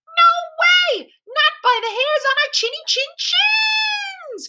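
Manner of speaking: very high, loud squealing piglets; little pigs' cry. A narrator's reading. Three little pigs refuse the wolf entry, crying out. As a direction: shriek a defiant storybook refusal in piggy voice. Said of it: "cried the three little pigs".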